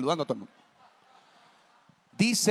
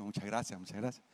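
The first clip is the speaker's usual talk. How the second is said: murmured thanks; 5 semitones lower, 14 dB quieter than usual. Retold or imitated. Monseñor's polite murmur.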